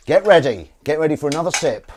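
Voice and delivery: rally the viewers loudly; rallying call. Real, not acted. Hyping viewers for sip-along.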